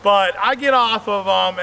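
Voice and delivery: usual speaking voice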